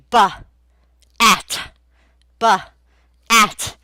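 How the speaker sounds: buh and at said as separate loud sounds; sounding out. Shown as a demonstration. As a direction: segment each sound for the child. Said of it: "how to pronounce a word".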